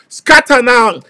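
normal talking voice

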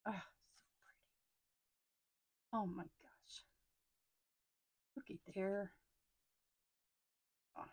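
breathless whisper of delight; awed whisper. Real, not acted. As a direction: whisper it like it's too precious to say aloud. Awed over sticker sheet.

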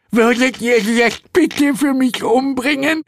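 very high, strained screech; squealed, exasperated request; seething Hel. A narrator's reading. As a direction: plead for a killing through a mangled mouth. Mangled Hel begs for murder.

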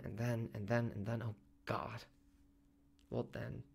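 faltering dread. A narrator's reading. Husband wondering what comes after surgery, faltering quietly. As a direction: lose heart as the words repeat.

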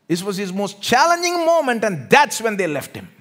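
loud, high cries on the key words; full-force preaching. Real, not acted. Preacher on Jesus' most challenging hour, loud impassioned preaching.

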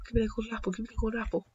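hushed, repeated plea; urgent muttering. Real, not acted. Player begging for a gold apple, urgent muttering. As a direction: plead quickly under the breath.

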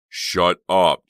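loud, angry snap; snapping. A narrator's reading. Uncle silencing his wife, angrily snapped. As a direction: cut her off with force. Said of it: "James snapped".

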